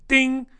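high, short "ding"; imitated bell ding. Host ticking off a list item, high ding. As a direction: chime it like a checklist bell.

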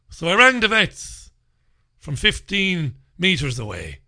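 ordinary delivery

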